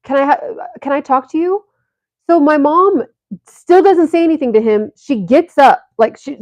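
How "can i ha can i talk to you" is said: lightly mimicked smooth-talking stranger; a hint of a creepy flirt, higher; mock sweet-talk to a stranger; intruder's line. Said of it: "is like".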